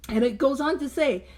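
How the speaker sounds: ordinary talk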